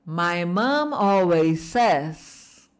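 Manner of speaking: joined up but very slow and clear; slow whole phrase. Shown as a demonstration. Teacher joining the phrase for students to echo. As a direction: say it all together, slowly and loudly. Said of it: "Bora juntar tudo?".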